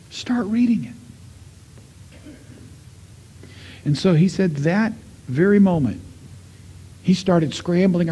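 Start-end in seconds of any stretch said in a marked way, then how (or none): 0.1-2.3 slow, higher, breathy whispered command. Retold or imitated. God whispering to the grieving man's heart, slow whisper.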